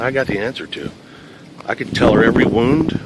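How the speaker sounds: ordinary talk, nothing marked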